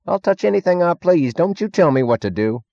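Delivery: high-pitched, belligerent retort; defiant drunk. A narrator's reading. Drunk Meggs refusing Stadler's order, defiant. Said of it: "waved it defiantly at the panel".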